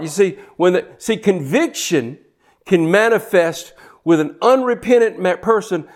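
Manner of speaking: usual speaking voice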